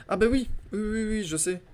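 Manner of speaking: high, eager ah yes; light-bulb moment; sudden realization. Real, not acted. Suddenly remembers key. (déclic soudain)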